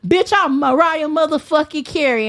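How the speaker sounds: ordinary talk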